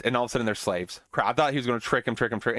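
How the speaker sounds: usual speaking voice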